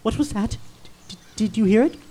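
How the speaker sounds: low, soft and halting; frightened hush. Acted. Ichabod hearing a sound in the dark, frightened hush. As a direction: drop to a nervous, stammering hush.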